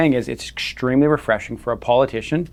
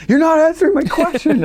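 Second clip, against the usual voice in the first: much higher, louder mock yell at the politician; imagined yelling; 5 dB louder than usual. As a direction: shout it up high like you've lost patience. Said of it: "like, yelling at him".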